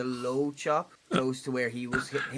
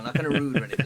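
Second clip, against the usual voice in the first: ordinary talk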